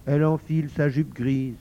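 plain reading voice

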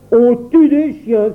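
cri stupéfait, lent et aigu; le père n'en revient pas. A narrator's reading. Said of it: "s'écria le père stupéfait". (s'écrier, stupéfait)

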